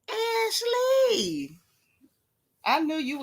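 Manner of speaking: high, squealed name; high delighted call; excited greeting. Real, not acted. Greeting a viewer.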